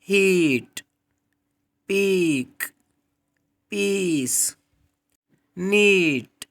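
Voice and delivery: teacher demo of long ee, stressed high; long ee words. Shown as a demonstration. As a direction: stretch the ee in हीट and पीक. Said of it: "For example".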